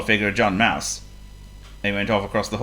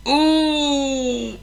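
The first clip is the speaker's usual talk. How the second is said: high, long drawn-out moan; pained ooh. A narrator's reading. Burglar on the floor rubbing his head, pained moan.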